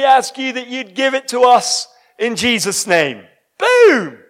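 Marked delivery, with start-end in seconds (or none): 3.6-4.2 triumphant boom. Acted. Declaring the prayer answered.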